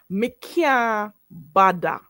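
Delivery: slow Patois "Me can't bother", can't as "kyah", bother as "badda"; Jamaican Patois pronunciation. Shown as a demonstration. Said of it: "So you say".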